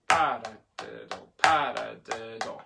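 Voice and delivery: slow paradiddle, first syllable punched; accent on first syllable. Shown as a demonstration. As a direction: land on PA, then ease down. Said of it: "two paradiddles with accents on the first".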